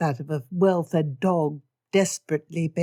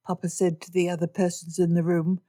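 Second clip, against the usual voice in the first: ordinary delivery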